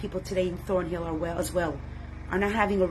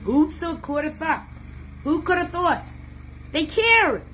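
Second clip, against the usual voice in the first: rising amazement; 8 semitones higher than usual. Acted. Preacher imagining Hashem's joy, rising excited cry. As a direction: build the awe up to a cry. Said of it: "imagined divine wonder".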